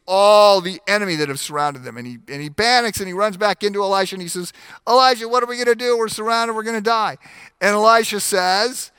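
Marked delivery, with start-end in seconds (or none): 4.9-7.2 fast, high, breathless panic; panicked servant. Acted. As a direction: rush it like the enemy is at the gates. Servant panics, surrounded by enemies.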